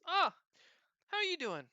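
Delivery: high, loud, chirpy hello; a little creature's bubbly hello; cheerful high greeting. Acted. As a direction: pop the greeting up bright and high. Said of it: "she just smiles and says".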